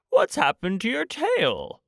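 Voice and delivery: jumps high in surprise; sudden high squeak of surprise; surprised Pooh. A narrator's reading. Pooh spotting Eeyore's missing tail, high and surprised. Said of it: "he said in surprise".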